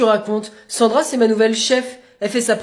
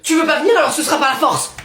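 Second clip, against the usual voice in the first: loud, raised-pitch warning; threatening; 5 semitones higher, 7 dB louder than usual. Acted. Father about to drag child to school, loud threat. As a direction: warn her at full voice.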